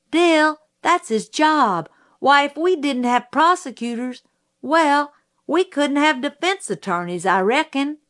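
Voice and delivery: put-on high-pitched child explaining patiently; reasoning child Scout. Child reasons with upset friend.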